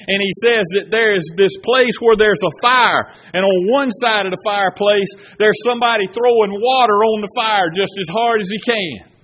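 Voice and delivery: full-voiced sermon storytelling; preaching. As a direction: preach it to the congregation. Preaching the Pilgrim's Progress story.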